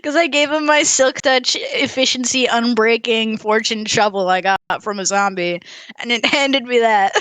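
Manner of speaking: normal talking voice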